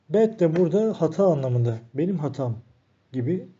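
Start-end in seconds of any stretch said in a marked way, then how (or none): none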